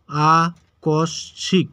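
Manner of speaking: আকস্মিক very slow, three syllables set apart; broken into syllables. Shown as a demonstration. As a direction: pause after each syllable of আকস্মিক. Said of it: "ভেঙে উচ্চারণ".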